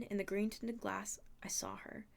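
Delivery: ordinary delivery, nothing marked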